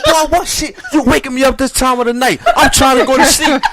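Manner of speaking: high, loud imitation of a cranky grandma woken at night; laughing mock scolding as grandma. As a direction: play the grumpy grandmother laying into the boy, half laughing.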